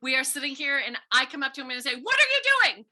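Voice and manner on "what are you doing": sharp shouted question, high and loud; angry shout at a friend; mock yell. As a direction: yell it at him like you're picking a fight. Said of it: "If I am yelling at Mister Angel".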